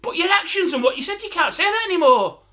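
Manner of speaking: lightly acted, high-pitched telling-off; officer's reprimand. Police officer warning the shouter, high reprimand. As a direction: scold as an indignant officer.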